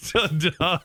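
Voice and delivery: deadpan ta-da, slight rise; lacklustre, underwhelmed reveal; unimpressed. Shown as a demonstration. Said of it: "Could you imagine it?".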